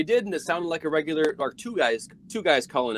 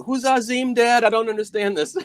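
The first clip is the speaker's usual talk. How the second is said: higher imitation of a confused kid; puzzled young boy; 7 semitones higher, 3 dB louder than usual. Boy asks Dad about the film, puzzled child voice. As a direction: play a child questioning Dad, pitched up. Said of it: "as a nine-year-old boy watching that".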